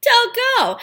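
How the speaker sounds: high, loud imitated mom warning; mom's warning. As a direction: mom pleading with her daughter not to go, high urgent warning. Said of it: "She's like".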